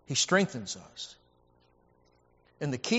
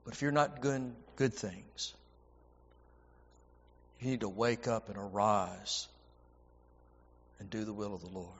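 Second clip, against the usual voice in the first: much softer, lower, earnest warning; hushed pulpit admonition, lower; quiet emphasis; 6 semitones lower, 9 dB quieter than usual. Acted. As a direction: drop the voice to press the point home. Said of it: "but emphasis, emphasis, emphasis".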